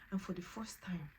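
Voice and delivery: ordinary talk, nothing marked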